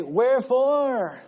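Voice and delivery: high, stretched-out challenge to God; protesting question, slow and lifted; drawn-out questioning. Acted. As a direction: draw the word out high and loud. Acted protest from the verse.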